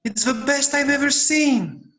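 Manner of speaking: thrilled by a new kite; boy gasps at the magnificent kite. Acted.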